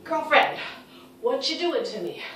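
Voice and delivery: complaining thighs. Pretend. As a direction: whine like sore thighs. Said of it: "inner and outer thighs are yelling".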